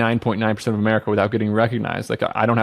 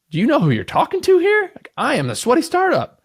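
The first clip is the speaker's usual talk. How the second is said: much higher, hammed-up indignant boast; mock arrogance; 14 semitones higher than usual. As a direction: puff up like a self-important big shot. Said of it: "Just kidding".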